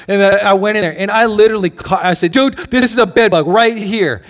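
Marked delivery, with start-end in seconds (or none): 2.3-4.2 mock outrage. Outraged at motel bed bug.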